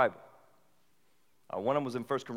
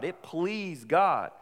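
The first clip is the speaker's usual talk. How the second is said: slow reverent quote; scripture reading; 5 semitones higher, 4 dB louder, slower than usual. Acted. Preacher reading Paul aloud, slow solemn. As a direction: read the verse slowly. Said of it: "He's speaking about God".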